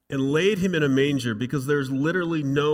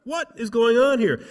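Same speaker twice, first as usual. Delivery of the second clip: shepherds' alarm; 10 semitones higher, 6 dB louder than usual. Pretend.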